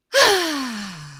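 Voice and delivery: long open sigh of relief, falling away. Shown as a demonstration. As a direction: let the breath drop out in a relieved sigh. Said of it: "let out a sigh of relief".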